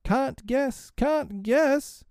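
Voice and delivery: put-on very high, gloating boy; gleeful high-pitched teasing; crowing. A narrator's reading. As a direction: taunt in a sing-out boyish crow. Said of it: "crowed Peter".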